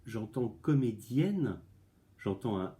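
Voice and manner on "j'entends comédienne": comédienne said very slowly, the final -enne stretched and clear; slow it right down on comédienne so pupils hear the è; drawn-out listening demo. Shown as a demonstration. Teacher shows pupils how to listen for the sound, slowly. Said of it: "Ah, j'entends".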